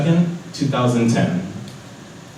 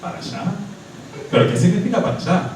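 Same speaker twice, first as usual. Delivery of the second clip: confused; 7 semitones higher, much faster than usual. Retold or imitated. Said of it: "And I'm like".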